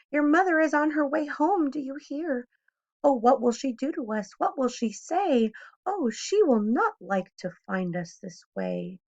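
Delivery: high, anxious fish voice; fretting that mother will catch them; fish in a fright. A narrator's reading. Fish warns the children, high and anxious.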